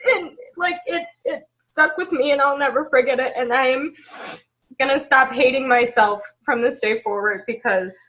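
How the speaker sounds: real crying, steadying herself; tearful resolve. Real, not acted. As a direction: speak through the tears with resolve. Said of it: "I'm gonna cry".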